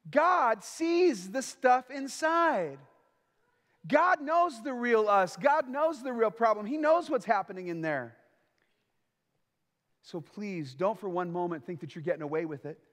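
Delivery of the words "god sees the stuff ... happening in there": slow, high, weighty preaching; preaching emphasis. Acted. Preacher presses the congregation, slow and high. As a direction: lean hard on each word about God.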